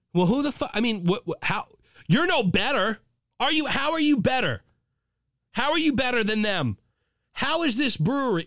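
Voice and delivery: high, heated accusation; angry rant. Real, not acted. Host ranting at the brewery, heated. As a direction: lay into them.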